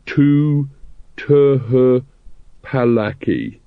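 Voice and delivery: stumbling over the letters; a hint of a dim hero sounding out words; lightly voiced halting reader. A narrator's reading. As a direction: read it like a slow, struggling hero. Hero reads poster haltingly.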